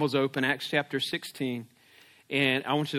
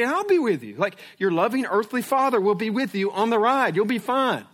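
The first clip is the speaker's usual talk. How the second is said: higher, gentle promise to stay beside him; reassuring father; 6 semitones higher, 3 dB louder than usual. Retold or imitated. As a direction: comfort your nervous son. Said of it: "I said".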